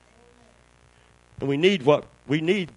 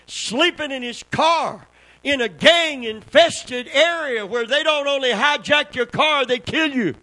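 preaching at full force; 9 semitones higher, 5 dB louder than usual. Real, not acted.

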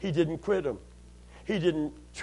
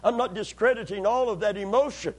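usual speaking voice

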